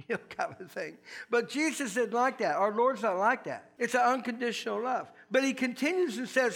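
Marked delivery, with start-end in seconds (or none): none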